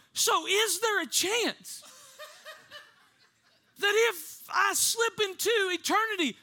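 put-on high, slow, pleading kid voice; worried small child. Four-year-old asking his mom about eternity, worried plea. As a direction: imitate an anxious little boy.

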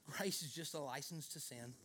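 put-on a lightly drawled Southern accent, low and soft; mock Southern preacher quoting a critic. Pastor mocking the grace-is-license crowd in a light Southern accent. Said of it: "I always do a southern voice when I say that".

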